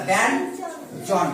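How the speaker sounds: ব্যঞ্জন slowly split into ben, then jon; dental n. Shown as a demonstration. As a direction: break ব্যঞ্জন apart with a clear n. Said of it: "দন্তন্যর মতো". (ব্যঞ্জন ভেঙে ধীরে, দন্ত্য ন-এর মতো)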